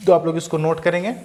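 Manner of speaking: usual speaking voice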